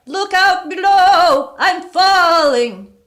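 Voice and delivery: high, slow, loud drawn-out wail; ghostly wail. A narrator's reading. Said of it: "the voice wailed once more".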